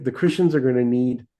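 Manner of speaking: ordinary talk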